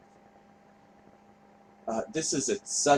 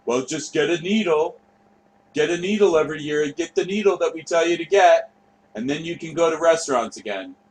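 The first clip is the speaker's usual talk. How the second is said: mock authority; 5 semitones higher, 3 dB louder than usual. Mocking the vaccine rules.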